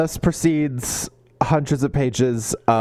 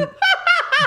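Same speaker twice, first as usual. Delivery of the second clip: laugh. Real, not acted. Laughing at own joke.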